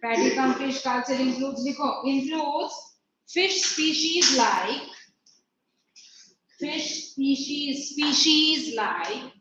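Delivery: slow dictation, drawn-out words; teacher spacing out each phrase; classroom dictation. Acted. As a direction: repeat key words slowly so pupils can write. Dictates the answer for writing.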